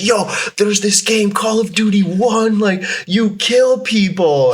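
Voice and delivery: put-on high, hyped-up kid voice, slowing on the end; excited kid friend. Friend hyping Call of Duty to a young boy, high excited voice. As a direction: play the eager friend bragging about a new game. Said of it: "He's like".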